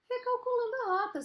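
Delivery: high, unruffled GPS voice; simple, natural GPS. Acted. GPS rerouting a lost driver, simple and natural. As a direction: announce the reroute without any fuss. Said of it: "simples e natural".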